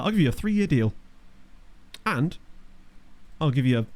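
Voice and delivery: ordinary talk, nothing marked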